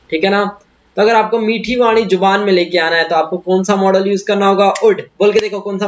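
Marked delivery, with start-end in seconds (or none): none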